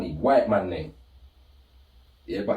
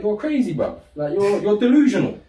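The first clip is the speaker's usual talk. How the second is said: raised pitch, incredulous telling-off; exasperated disbelief; 9 semitones higher, 4 dB louder than usual. Retold or imitated. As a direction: tell your brother off in disbelief. Retold row with his brother.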